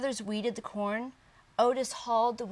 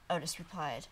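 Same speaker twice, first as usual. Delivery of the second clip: plain reading voice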